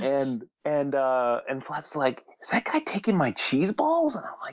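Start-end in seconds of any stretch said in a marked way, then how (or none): 2.4-4.2 high, disbelieving question; incredulous. Retold or imitated. Floored friend watches a man raid his cheese balls, incredulous. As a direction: ask it high-pitched, in mild disbelief.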